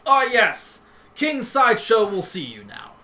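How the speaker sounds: guard. Acted. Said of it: "one of the pawn guards... he goes".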